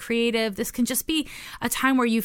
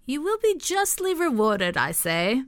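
court jester; 3 semitones higher than usual. Acted. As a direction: proclaim it like a playful jester. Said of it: "Sorry for saying it like a jester".

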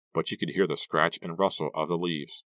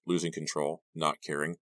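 plain reading voice

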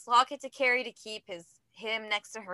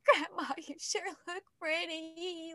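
slow, higher, choked mock sob of the lyric; hammed-up teary version of the lyric; imitating crying; 6 semitones higher, 5 dB quieter, slower than usual. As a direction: play yourself tearing up on "Grandma". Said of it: "Like, crying".

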